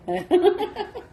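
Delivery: real laugh. Real, not acted. Laughing at own story.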